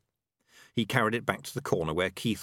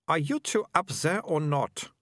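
annoyed; 8 semitones higher, slower than usual. A narrator's reading. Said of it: "staring up at him in annoyance".

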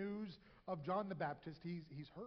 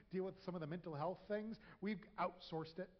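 ordinary talk, nothing marked

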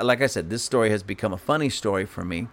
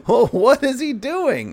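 high, louder, slow confused question; puzzled guest; 5 dB louder, slower than usual. Retold or imitated. Guest asking hosts about their dog, high puzzled voice. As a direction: squeak out the guest's bewilderment.

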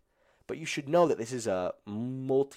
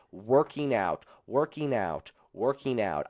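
working out stressed each time, louder; emphasized repetition; 2 semitones higher, 5 dB louder, slower than usual. Real, not acted. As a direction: hammer home working out. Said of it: "I am emphasizing working out".

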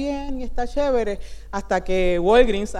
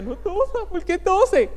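higher, hammed-up fussing at coughers; mock scolding plea; 8 semitones higher, 4 dB quieter than usual. Scolding coughing coworkers in mock alarm, higher pitched. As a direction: play your own overblown alarm at someone coughing. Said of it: "La gente tosía y yo decía".

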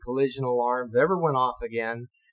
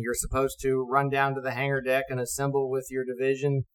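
ordinary talk, nothing marked

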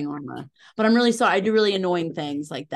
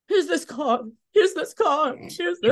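frantic handing over; 9 semitones higher, 4 dB louder, slower than usual. Retold or imitated. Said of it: "I'm just like".

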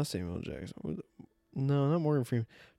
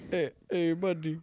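higher put-on Indian accent; mock Indian accent; 8 semitones higher than usual. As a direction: echo it in a playful Indian accent. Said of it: "You going Indian?".

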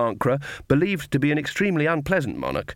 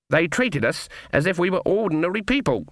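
shocked; 6 semitones higher than usual. Acted. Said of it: "in a shocked voice".

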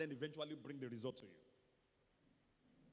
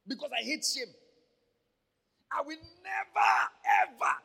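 shouted from the spirit; 11 semitones higher, 16 dB louder than usual. Real, not acted. Pastor to the congregation, declaring it from his spirit, shouting. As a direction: cry it out from the spirit. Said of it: "You might think it's just emphasis".

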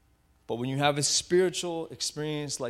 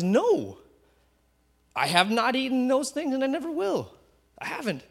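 higher, louder indignant refusal; Peter protesting aloud; 10 semitones higher, 4 dB louder than usual. Acted. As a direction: Peter refusing firmly, voice raised high. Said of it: "and he says aloud".

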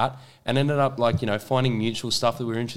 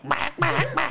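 high squeaky duck quack; slower than usual. Retold or imitated. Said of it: "he's on stage going".

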